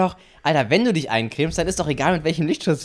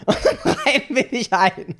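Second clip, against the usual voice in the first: laughing; 6 semitones higher, slower than usual. Real, not acted. Teasing, laughing mid-sentence.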